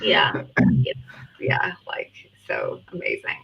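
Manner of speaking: a mock California valley girl, barely voiced; California accent. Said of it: "This is me trying to do a California accent".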